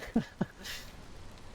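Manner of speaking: short soft chuckle; embarrassed laugh. Real, not acted. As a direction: laugh it off quietly. Laughs at own voice crack.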